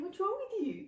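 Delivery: imitating mum calling into the room; mum in dream. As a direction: voice mum asking from the doorway. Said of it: "My mum would be there going".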